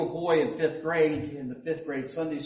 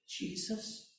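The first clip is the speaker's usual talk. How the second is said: soft, unvoiced, unsure reply; a shy boy guessing under his breath; timid answer; 3 semitones higher, 11 dB quieter than usual. Fifth grade boy answers the teacher, whispered and unsure.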